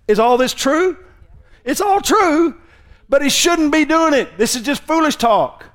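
very high, loud sermon delivery; impassioned preacher in full cry; forceful preaching. Real, not acted. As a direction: hammer the point at the top of your voice.

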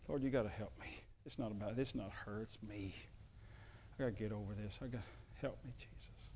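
soft, pleading prayer fading to a whisper; quiet desperate plea to Jesus; hushed prayer. Retold or imitated. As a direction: pray under your breath, begging for help. Retold whispered prayer to God.

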